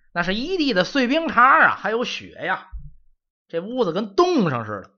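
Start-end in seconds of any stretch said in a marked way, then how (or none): none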